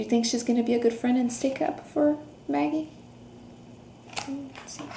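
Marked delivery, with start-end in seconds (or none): none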